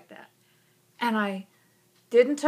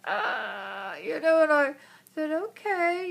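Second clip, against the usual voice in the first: small choked whimper, then higher meek okay; upset child; 6 semitones higher than usual. Retold or imitated. Daughter hears grim answer, near tears. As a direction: sound like a little girl about to cry.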